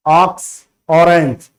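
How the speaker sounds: ordinary talk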